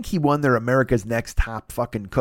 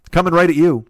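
announcer catchphrase; 4 semitones higher, 6 dB louder than usual. Pretend.

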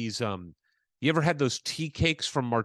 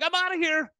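much higher, hammed-up carefree exit line; mock breezy. Leaving the table with his tea cake, high mock breezy exit. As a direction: toss it off like nothing is wrong.

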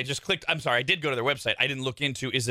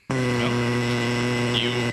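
usual speaking voice

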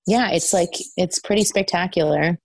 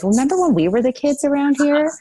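mock nostalgic amazement; 8 semitones higher than usual. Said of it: "And I'm like".

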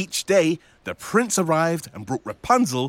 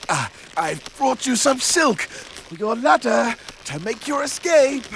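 higher, cheery prince voice; 7 semitones higher than usual. Acted. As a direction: greet her brightly as the prince. Prince arrives with silk.

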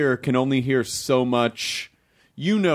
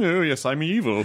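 mock posh English accent, a bit higher; a quick hammed-up posh English "I'm evil"; tossed-off posh English baddie impression; posh English villain; 5 semitones higher than usual. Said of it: "or a poshy kind of a".

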